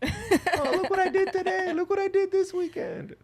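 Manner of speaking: high, squeaky show-off mockery; mocking voice. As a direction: squeak it like a bragging poser.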